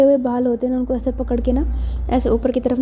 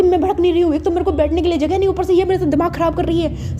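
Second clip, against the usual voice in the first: high, raised, rushed complaint; flaring up; 6 semitones higher, 3 dB louder than usual. Acted. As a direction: protest hotly while denying the anger. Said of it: "इतना क्यों भड़क रही है".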